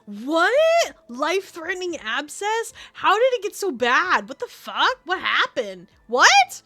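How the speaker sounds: loud, high, incredulous questions; can't believe what she just read; real shock. Real, not acted. Reacting to Taco's abscess story, loud shocked disbelief.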